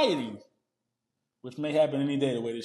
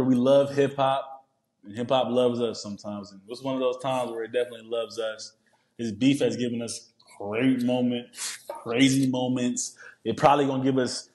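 usual speaking voice

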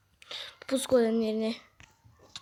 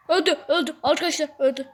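loud, raised-pitch repeated cries; excited announcement; 4 semitones higher, 7 dB louder, much faster than usual. Real, not acted. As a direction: burst out with the news to viewers. Excitedly announcing a death in game. (heyecanla bağırarak)

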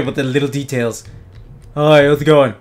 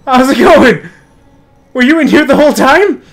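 high, loud, laughing surprise; startled greeting; 8 semitones higher, 5 dB louder, slower than usual. Real, not acted. Startled greeting to game character.

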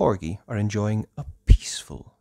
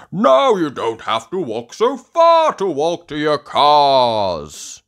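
loud, hearty, raised character voice; Grampy Rabbit; 8 semitones higher, 9 dB louder than usual. Acted. Grampy Rabbit reassures the sailors, loud hearty voice. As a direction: announce it heartily. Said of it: "sings Grampy Rabbit".

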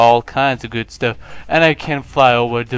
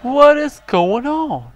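high, loud and drawn out, slow in disbelief; stunned excitement; 13 semitones higher, 10 dB louder, slower than usual. Real, not acted. Player baffled by his floating character, high and amazed. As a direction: squeal in amazed confusion.

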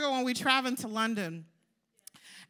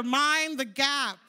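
slow, spaced call-and-response; much slower than usual. Shown as a demonstration. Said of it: "Say to your neighbor".

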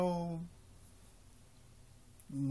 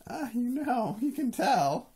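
playful exclaim; 12 semitones higher, 10 dB louder, slower than usual. Real, not acted. Caught out, playful.

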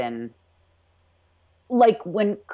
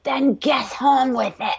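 higher, slower mock Scots accent; bad Scottish accent; 7 semitones higher, slower than usual. Fan imitating a fed-up prisoner talking back, bad Scottish accent. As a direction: have a clumsy go at a Scottish accent. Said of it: "that was the worst accent ever".

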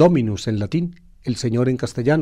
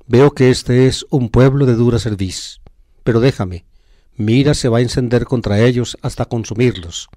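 louder, solemn reading of the Bible text; scripture reading; 5 dB louder than usual. Acted. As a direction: proclaim the passage to the congregation. Homilist reads the Bible passage aloud. (lectura bíblica solemne y más fuerte)

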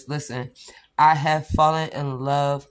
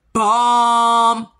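excited exclaim; 5 semitones higher, 8 dB louder than usual. Real, not acted. Excited about pool party polish.

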